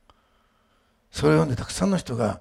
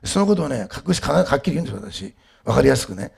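normal talking voice

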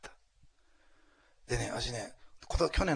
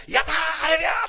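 mock jubilant cheer; 12 dB louder than usual. Preacher playing believers celebrating Peter's release, mock jubilant. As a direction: whoop and cheer, hammed-up. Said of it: "とか言ってね、言うでしょ？".

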